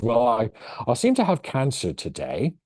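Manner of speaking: put-on British accent. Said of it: "which I can't do the accent".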